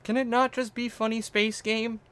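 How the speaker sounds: very high put-on pleading voice; mock plea. Streamer pleading about the game, high mock whine. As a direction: plead in a high, silly little voice.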